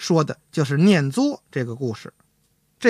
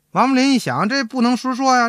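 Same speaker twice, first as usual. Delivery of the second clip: usual speaking voice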